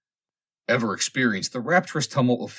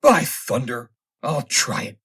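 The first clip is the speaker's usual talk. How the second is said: determined; 3 semitones higher, 6 dB louder, slower than usual. A narrator's reading. Inventor resolves on experiment.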